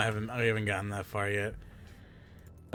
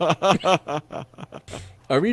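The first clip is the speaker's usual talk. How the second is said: mock contrite; 8 semitones higher, 7 dB louder, much slower than usual. Mock apology under teammates' anger.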